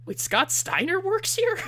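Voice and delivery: mock surprise. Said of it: "he's like".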